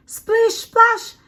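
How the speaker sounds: high, bouncy splash sound effect; playful splashy sound word. A narrator's reading. Reading the penguin book to children, a high playful splash. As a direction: pop the splash up high for the kids.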